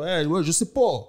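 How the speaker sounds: casual Quebec French "je sais pas", ne dropped, last vowel rounded to "po". Shown as a demonstration. Showing how locals really talk, Quebec French. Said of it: "Ils vont dire".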